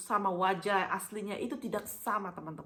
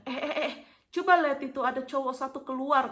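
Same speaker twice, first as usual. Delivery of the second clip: higher, louder call to look; excited alert; 4 semitones higher, 4 dB louder than usual. Retold or imitated. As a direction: imitate the driver urgently pointing someone out. Driver suddenly spots a man emerging. (seruan heboh pak sopir)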